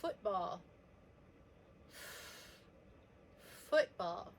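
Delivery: long drawn-out F opening FOOTBALL; stretched first sound. Shown as a demonstration. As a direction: hold the F at the start of Football. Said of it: "I hear a different sound".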